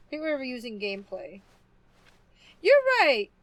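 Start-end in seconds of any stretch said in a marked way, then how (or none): 2.6-3.3 loud high exclaim. Real, not acted.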